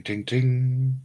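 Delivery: ordinary talk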